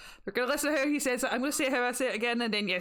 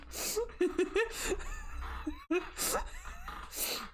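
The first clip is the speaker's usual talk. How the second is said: высокий захлёбывающийся хохот; взрывается визгливым смехом, почти до крика; helpless shriek of laughter; 11 semitones higher, 6 dB quieter than usual. Real, not acted. Cracking up at the meme.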